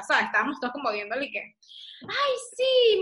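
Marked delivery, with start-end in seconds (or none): 2.0-3.0 high, eager fake agreement; mock agreement. Daughter imitates her forgetful mother faking recognition, high and eager. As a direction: play along brightly. Imitating mom playing along.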